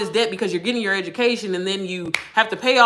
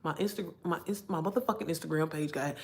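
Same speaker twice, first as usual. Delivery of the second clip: low, muttered frustrated repeat; muttered cursing; 4 semitones lower, 13 dB quieter than usual. Retold or imitated. Telling viewers how she muttered when hacked. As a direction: mutter it under your breath in disbelief. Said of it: "I said".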